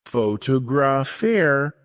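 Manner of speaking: "Photographer" slowly, stressing "tog"; second-syllable stress. Shown as a demonstration. Teacher giving learners an example, second-syllable stress on "Photographer". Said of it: "stress on the second syllable".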